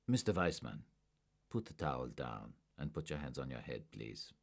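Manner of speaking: low, soft, firm command; quiet order. A narrator's reading. Agent ordering a suspect to drop the towel, quietly firm. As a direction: order him down without raising the voice. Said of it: "Werner ordered".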